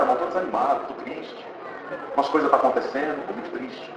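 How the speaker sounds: a hushed, sagging voice that fades almost to nothing; despondent son. Retold or imitated. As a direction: let the words sink under the sadness. Son confesses despair to pastor.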